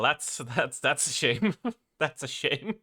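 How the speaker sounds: ordinary talk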